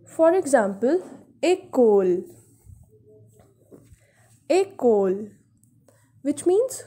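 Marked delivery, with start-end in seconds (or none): 1.4-2.2 école opening on "ay": "ay-kol"; accent aigu pronunciation. Shown as a demonstration. Teacher giving an example of accent aigu, école as "ay-kol". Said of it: "we pronounce this letter as A". 4.5-5.3 École as "ay-kol", carefully repeated; accent aigu pronunciation. Shown as a demonstration. Teacher repeating the example for viewers, École as "ay-kol". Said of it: "we pronounce this letter as A".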